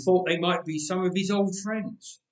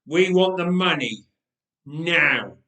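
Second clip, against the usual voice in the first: louder, hard threat; menacing demand; 7 dB louder, slower than usual. Acted. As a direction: lean on him, demand it now. Thug demands money.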